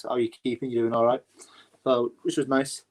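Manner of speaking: normal talking voice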